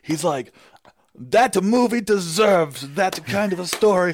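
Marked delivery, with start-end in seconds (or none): 1.1-4.1 grumpy grandpa impression, higher and drawn out; Lucio Fulci. Fulci reacting to a fan's theater story, grumpy grandpa impression. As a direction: imitate a larger-than-life old director making a pronouncement. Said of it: "everyone's favorite grumpy grandpa".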